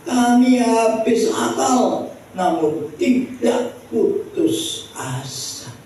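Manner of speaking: high, slow, ringing declamation; proclaimed scripture quote. Acted. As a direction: declare each clause slowly, pitched high. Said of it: "Dia berkata begini". (kutipan rasul yang diserukan lantang)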